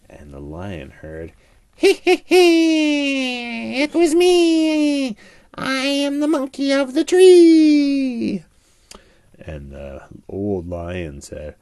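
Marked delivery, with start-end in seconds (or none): 1.7-8.4 high, slow, giggly monkey voice; cheeky monkey. A narrator's reading. Monkey teases the lion from the tree, high and giggly. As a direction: sing it out like a sneaky little monkey.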